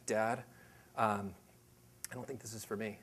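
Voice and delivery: hushed, low imitation of a timid son; scared child, imitated. Scared son telling his dad he can't do it, timid imitation. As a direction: back out softly, with hesitant pauses. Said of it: "he's so scared that he almost, like, started shaking".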